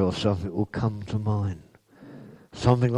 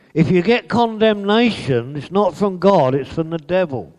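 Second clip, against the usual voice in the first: high, loud warning; preaching; 9 semitones higher, 6 dB louder than usual. Acted. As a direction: preach it out hard. Preaching emphasis.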